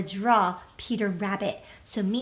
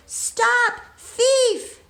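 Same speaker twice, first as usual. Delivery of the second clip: loud, much higher shout of Stop, thief; 13 semitones higher, 9 dB louder than usual. Acted. Mister McGregor chasing Peter, loud high shout. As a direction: yell after the thief across the garden.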